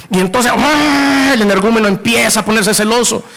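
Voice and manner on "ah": drawn-out high slow "¡ah!"; hammed-up gasp of suspicion; mock gasp. Jealous spouse notices message.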